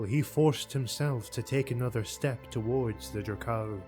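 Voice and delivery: plain reading voice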